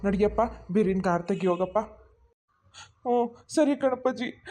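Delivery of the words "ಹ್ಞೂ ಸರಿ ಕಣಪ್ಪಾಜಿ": answering through tears; tearful, choked reply; crying. Acted. Son answering his father while crying. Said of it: "ಹಿಂಗ್ ಅಳ್ತಾ ಕೂತ್ಕೊಂಡ್ರೆ ಆಗಲ್ಲ".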